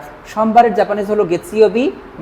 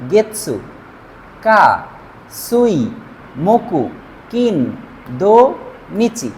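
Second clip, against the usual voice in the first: each short syllable isolated, with pauses between; drill recitation; much slower than usual. Shown as a demonstration. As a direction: list them one by one for the class to repeat. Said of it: "এই ক্ষেত্রে কি হবে?".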